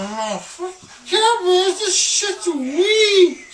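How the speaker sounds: high, loud laughing sob; half laughing, half crying; laugh-crying rant. Real, not acted.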